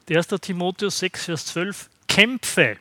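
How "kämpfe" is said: forceful command. Acted. Urging the scripture command.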